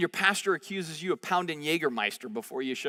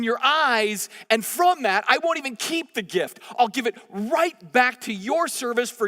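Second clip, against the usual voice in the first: heartfelt prayer; 5 semitones higher, 4 dB louder than usual. Retold or imitated. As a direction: lift it up like a pleading prayer. Paraphrasing Hannah's prayer.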